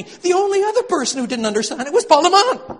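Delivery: high and loud, breaking into laughter; laughing. Real, not acted. Lecturer laughing at the critic to the audience, laughing. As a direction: laugh at the absurdity of it.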